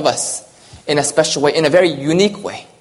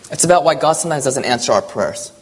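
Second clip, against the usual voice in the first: usual speaking voice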